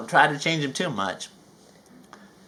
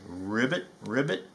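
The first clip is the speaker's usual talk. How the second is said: soft frog croak; 4 semitones lower, 6 dB quieter than usual. Acted. Frog noise in the mist.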